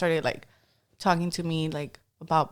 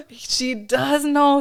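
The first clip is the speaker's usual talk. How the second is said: shocked; 8 semitones higher, much slower than usual. Retold or imitated. Dad amazed his little daughter knows the Astros player, shocked and slow. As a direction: act out stunned disbelief.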